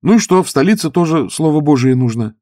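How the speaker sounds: put-on young priest preaching to stoker; preaching youth. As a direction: preach it back. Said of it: "продолжал проповедовать молодой священник".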